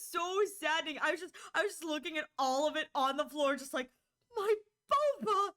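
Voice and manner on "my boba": high, slow mock lament; hammed-up high wail. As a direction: cry out for the boba like a child. Looking at spilled tea.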